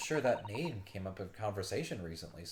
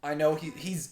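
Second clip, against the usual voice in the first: normal talking voice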